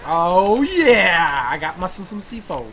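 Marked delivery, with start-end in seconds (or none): 0.1-1.5 delighted oh yeah. Real, not acted. Finds C4, delighted.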